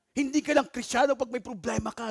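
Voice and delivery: ordinary talk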